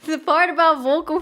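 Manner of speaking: words slowed by laughter; can't get it out for laughing. Real, not acted. Laughing mid-story.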